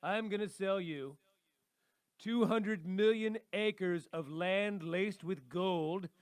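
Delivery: loud, slow, grandiose huckster voice; toupee-wearing salesman. Acted. Co-host playing a toupee-wearing dealmaker selling land, grandiose salesman. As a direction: pitch it big, stretching out every boast. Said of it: "put a toupee on my head, and I'll be like".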